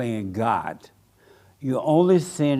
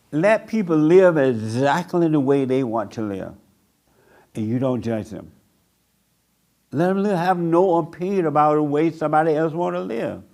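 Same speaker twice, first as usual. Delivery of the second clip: ordinary delivery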